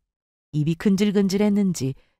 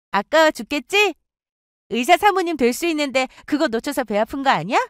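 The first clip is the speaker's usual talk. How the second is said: higher, needling jealous friend voice; jealous friend goading the narrator; taunting friend; 7 semitones higher than usual. Acted. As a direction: poke at her with a sneer.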